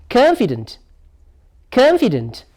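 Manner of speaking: "Confident" pitched high, then falling away; "Confident" as a teacher's stress example; stressed-syllable demo. Shown as a demonstration. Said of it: "The stress lie on here now".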